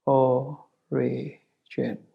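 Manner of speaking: "Origin" stretched into three slow, separate syllables; slow, gentle rhythm. Shown as a demonstration. Guide modelling the linkage word for listeners, in slow gentle rhythm. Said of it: "repeat this word in slow, gentle rhythm".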